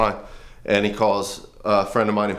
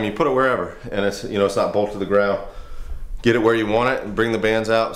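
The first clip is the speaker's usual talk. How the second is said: ordinary talk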